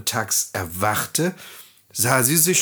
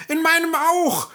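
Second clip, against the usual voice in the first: very high and louder, falling, stress on meinem; excited dwarf call; 5 dB louder than usual. A narrator's reading. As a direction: shout it with the tune dropping off. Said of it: "riefen die Zwerge jetzt durcheinander".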